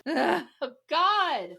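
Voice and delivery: ordinary delivery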